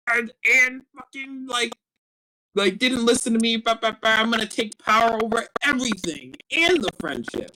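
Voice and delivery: high-pitched mock rant of a reactive hothead; Sasha. Podcaster parodies reactive character's power grab, high mock rant. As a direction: blurt it out high, like a reactive kid ranting. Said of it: "season two Sasha is a lot more like".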